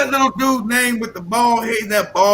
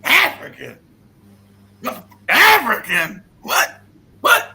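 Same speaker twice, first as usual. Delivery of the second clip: rising, incredulous protest; mock irate disbelief; 5 semitones higher, slower than usual. Mimicking an irate mixed man, mock disbelief. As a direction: play someone scandalised by the word. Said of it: "They got irate".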